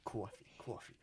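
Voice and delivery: soft New York "Coffee, coffee" warm-up; "Coffee, coffee" as "kwawfee", under the breath; New York accent warm-up, very quiet. Retold or imitated. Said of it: "Do a New York accent".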